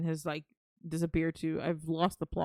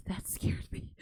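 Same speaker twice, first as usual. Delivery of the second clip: whispered fright; 4 dB quieter, slower than usual. Real, not acted. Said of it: "That genuinely scared me".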